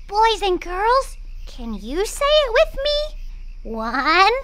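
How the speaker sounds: put-on bright host leading children; kids show host. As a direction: coax the kids to join in. Host leads kids in reciting.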